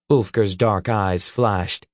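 plain reading voice

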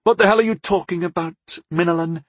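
high, exasperated goddess; baffled demand. Acted. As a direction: demand an explanation, pitched right up.

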